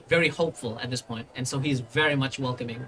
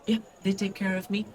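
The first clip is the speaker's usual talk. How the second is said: put-on higher, halting child voice; child recounting; 4 semitones higher than usual. Child explaining the attack to the party, small halting voice. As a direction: tell it simply, pausing like a kid. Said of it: "And he looks like".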